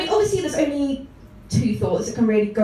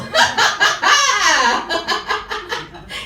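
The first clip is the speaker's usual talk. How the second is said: high, loud hammed-up cackle; ecstatic squealing laugh; 8 semitones higher, 5 dB louder than usual. Fan thrilled about George Orwell, shrieking laugh. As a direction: burst into a long overjoyed laugh. Acting overjoyed at Orwell date.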